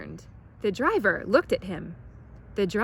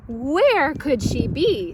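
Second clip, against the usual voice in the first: loud, slow fretful question; worried; 7 semitones higher, 8 dB louder, slower than usual. Acted. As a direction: fret aloud. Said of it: "said Eugenia".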